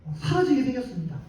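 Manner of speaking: preacher voicing Israel's loud lament; wailing prayer. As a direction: cry out to God over the lost tribe. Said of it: "울면서 통곡하면서 하나님께 이렇게 말하는 거예요".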